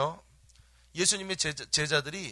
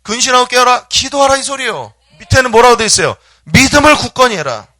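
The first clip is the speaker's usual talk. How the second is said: loud, high exhortation at full force; forceful preaching shout; 7 semitones higher, 17 dB louder than usual. Real, not acted. Preacher urging congregation to watch and pray, full-voiced shout. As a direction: cry out each command.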